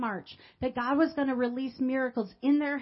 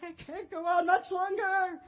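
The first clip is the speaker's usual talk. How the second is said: screaming with tears; 7 semitones higher than usual. Retold or imitated. Screaming at God with tears streaming down her face. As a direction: cry out enough, pitch climbing high. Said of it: "And I began screaming it".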